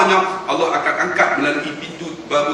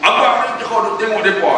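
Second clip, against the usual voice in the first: wife snapping; 6 semitones higher, 3 dB louder than usual. Retold or imitated. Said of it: "dia tengking saya".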